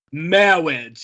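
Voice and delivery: "Marriage" said as a drawn-out "mawwage"; clergyman's mangled marriage. Retold or imitated. Fan imitating the clergyman's entrance, "Marriage" as "mawwage". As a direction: stretch "Marriage", turning the R into W. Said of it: "it comes out just right away".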